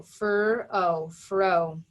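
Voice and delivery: "fur, O, fro" said in separate sounds, then blended; phonics-style blending of "fur, O, fro"; sounded out. Shown as a demonstration. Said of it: "if I sound this out".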